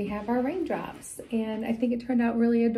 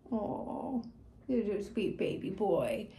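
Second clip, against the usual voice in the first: soft cooing; 6 dB quieter, slower than usual. Real, not acted. Cooing softly to cat.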